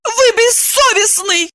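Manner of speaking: high-pitched slow accusation; outraged cry. A narrator's reading. Woman taking back her dog, outraged cry. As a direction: cry it out, scandalised. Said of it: "крикнула она".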